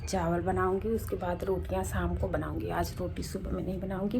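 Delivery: soft, hushed kitchen talk; speaking softly. Real, not acted. Vlogger keeping voice down, people nearby, speaking softly. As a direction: keep it down so the neighbours don't hear. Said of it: "मैं थोड़ा धीरे धीरे बोल रही हूँ".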